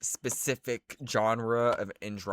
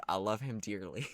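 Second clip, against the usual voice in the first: ordinary talk, nothing marked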